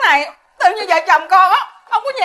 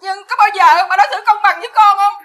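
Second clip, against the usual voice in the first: usual speaking voice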